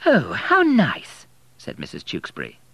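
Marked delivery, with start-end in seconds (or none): 0.1-1.3 very high, slow, gushing lady; delighted vicar's wife. A narrator's reading. Vicar's wife gushing at the wedding news, high and slow. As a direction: coo with delight.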